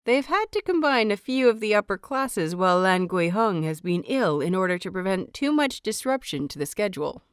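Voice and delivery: ordinary delivery, nothing marked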